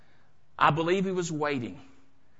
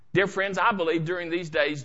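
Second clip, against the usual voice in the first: normal talking voice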